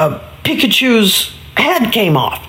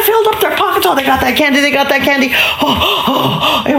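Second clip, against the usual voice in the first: high, breathless-with-glee climax of a tale; excited storytelling; 10 semitones higher than usual. A narrator's reading. Storyteller as the piñata breaks open, high excited glee. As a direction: build to a squealing, laughing high pitch.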